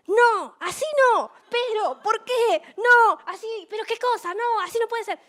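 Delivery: high, loud frantic yelling at a football match; hammed-up protest at a bad play; retold shouting at the TV. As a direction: yell at the players like a desperate fan. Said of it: "Pero no grites".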